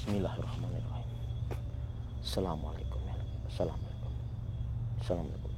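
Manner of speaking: hushed, breathy whisper of prayers and greetings. Real, not acted. Uneasy vlogger whispering greetings to unseen spirits, hushed. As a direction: whisper the prayers as if something is listening.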